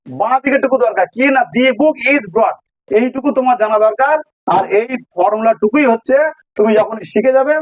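ordinary talk, nothing marked

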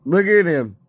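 ordinary delivery

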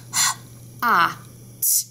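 teacher models the separate sounds of hot: H, ah, t; sounding out hot. Shown as a demonstration. Teacher to class, sounding out hot one sound at a time. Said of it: "Let's do the sounds of hot".

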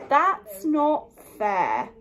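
a put-upon bear whining; slow sulky complaint. Acted. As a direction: sulk over each word. Bear complains about fairness.

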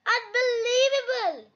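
high-pitched exclamation of disbelief; astonished cry. Acted. Labrador sees Mummy Pig hit the middle, astonished cry. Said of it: "cries the Labrador".

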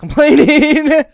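high, loud, drawn-out complaint; exasperated protest. Real, not acted. Host to his friend over the cheap mic, high exasperated protest.

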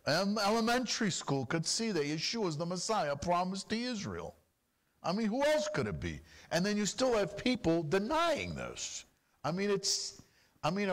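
usual speaking voice

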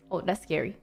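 ordinary delivery

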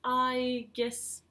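ordinary delivery

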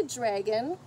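ordinary delivery, nothing marked

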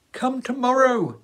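high, loud call after her; shouting. A narrator's reading. Philip shouting after the departing girl, loud call. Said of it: "he shouted".

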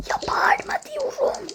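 whispered scolding; exasperated whisper. Acted. A kid scolding a friend not to shout, whispering. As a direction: scold them with no voice at all.

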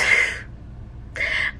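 breathy tearful laugh; a soft laugh on the edge of crying; teary laugh. Real, not acted. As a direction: laugh through rising tears. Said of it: "I wanna cry right now".

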